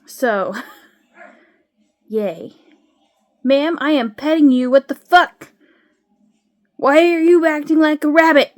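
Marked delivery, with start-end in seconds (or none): 3.4-8.5 loud, high-pitched disbelief; real indignant outburst, pitched up; exasperated scolding. Real, not acted. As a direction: tell off a pet, raised voice. Scolding her misbehaving cat.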